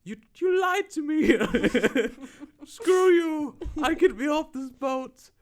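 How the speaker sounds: very high, loud, drawn-out wail; hammed-up tearful screech; mock hysterical fury. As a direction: imitate a betrayed woman shrieking in outrage. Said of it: "And she's like".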